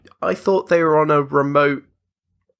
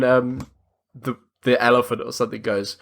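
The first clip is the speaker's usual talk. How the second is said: ordinary talk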